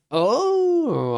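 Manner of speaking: high fake cry on oh; hammed-up cry of dismay; mock wail.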